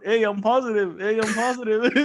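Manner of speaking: high, loud announcement with a laugh; calling out. Retold or imitated. Telling everyone around the store, laughing and calling out. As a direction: re-enact calling it out to all, laughing.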